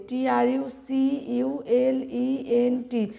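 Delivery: slow, letter-by-letter spelling. Shown as a demonstration. Teacher spelling a long word for students, slow spelling. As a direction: spell each letter out slowly.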